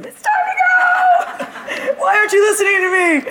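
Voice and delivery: ordinary talk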